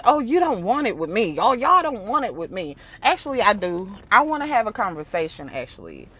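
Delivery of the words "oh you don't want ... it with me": swaggering mock brag, higher; imitating a cocky man; mocking his boast. Quoting Godfrey's boast, mock swagger. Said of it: "You said".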